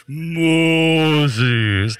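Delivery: long, drawn-out booming call; hammed-up voice of God from the burning bush; creepy God calling. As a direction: stretch the name out slow and eerie. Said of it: "he's calling Moses from the burning bush".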